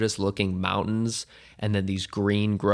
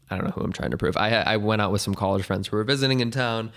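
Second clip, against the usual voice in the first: ordinary delivery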